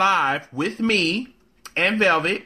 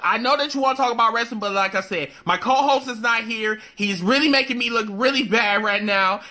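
high, loud, pleading plea to the chat; 11 semitones higher, 3 dB louder than usual. Real, not acted. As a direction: beg them to talk, higher each time. Begging silent viewers to talk.

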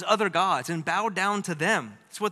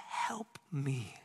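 unvoiced plea, barely there; whispered prayer; 3 semitones lower, 10 dB quieter than usual. Shown as a demonstration. Preacher showing how he quietly asks God for help, whispered. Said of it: "I just say".

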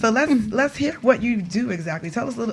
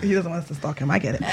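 normal talking voice

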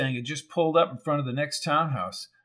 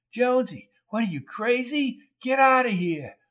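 high, pushing him away with a yell; a hint of a pained shout; wounded Coco yells at Jones to get out; urgent yell; 9 semitones higher than usual.

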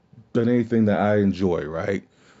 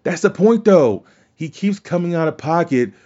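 emphatic protest; 6 semitones higher than usual. Real, not acted. Podcaster answering an angry fan post, emphatic and raised. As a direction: insist on it, voice jumping up. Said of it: "someone who said that they were mad".

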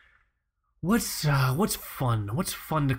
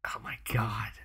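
whisper; 4 semitones lower, 8 dB quieter than usual. Real, not acted. Awed aside.

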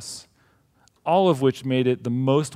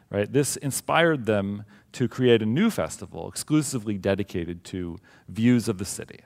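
normal talking voice

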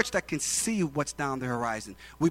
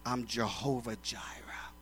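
voice of God; 7 semitones lower, 12 dB quieter than usual. Acted. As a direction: let it sink almost to a whisper. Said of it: "God says".

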